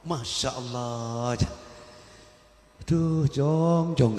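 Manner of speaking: slow, drawn-out fatherly sigh; father's gentle lament. Retold or imitated. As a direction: stretch it out like a dismayed but kind father.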